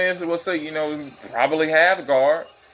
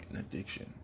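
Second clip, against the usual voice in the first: whispered echo, much softer; 20 dB quieter, faster than usual. Real, not acted. Fight fan whispering his addiction to the viewers. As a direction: breathe it as a secret.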